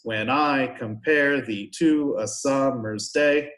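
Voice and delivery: slow da-DUM beat, punching I, compare, to, summer's, day; exaggerated iambic stress. Shown as a demonstration. Teacher showing the class what an iamb is, exaggerated iambic stress. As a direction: hammer every second syllable of "When I compare thee to a summer's day". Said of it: "Unstressed, stressed".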